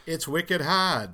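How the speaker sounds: put-on slow, loud Boston accent with dropped r; thick Boston drawl. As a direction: draw it out like a Boston local. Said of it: "a real Boston accent".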